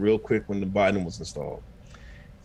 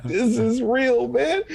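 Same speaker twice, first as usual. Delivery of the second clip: mock disbelief; 12 semitones higher, slower than usual. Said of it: "I was like".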